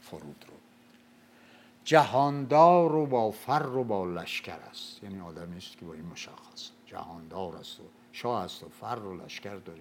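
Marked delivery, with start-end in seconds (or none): 1.8-5.0 slow, loud Shahnameh chant; epic verse recital. Acted. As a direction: declaim it like an old epic.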